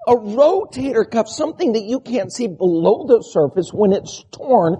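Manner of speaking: ordinary talk, nothing marked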